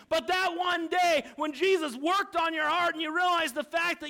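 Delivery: impassioned preaching. Real, not acted. Preacher urging the congregation to remember being saved, fervent. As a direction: drive each phrase home, climbing to a shouted peak.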